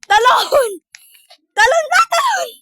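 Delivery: high, loud urgent shouts; excited shouting. Real, not acted. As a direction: yell at them to jump now. Urging partner to jump.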